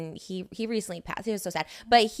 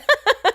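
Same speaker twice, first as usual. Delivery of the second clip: sudden high loud laugh; loud burst of laughter; 10 dB louder than usual. Real, not acted. Guest laughing hard at her own line, burst of laughter. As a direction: crack up loudly.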